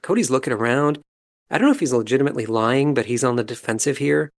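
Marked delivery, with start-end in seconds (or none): none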